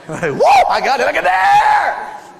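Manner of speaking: high, loud excited yell, stretched out; David hollering look at there; gleeful show-off. Acted. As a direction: crow over the trophy. David showing off the head.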